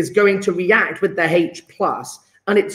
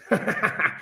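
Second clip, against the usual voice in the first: short laugh; 2 semitones higher, 7 dB quieter than usual. Real, not acted. Laughs at own pun.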